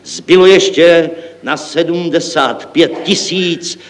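high, slow grand announcement. Acted. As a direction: proclaim it proudly, stretching the words. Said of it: "zvýšil jsem hrdě hlas".

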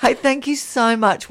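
usual speaking voice

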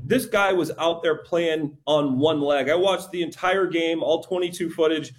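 ordinary talk

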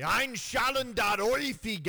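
loud, gruff mock German; hammed-up angry German accent. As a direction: bark Ein Schalen der Ohrfeige like an angry German. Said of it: "say it with a really angry accent".

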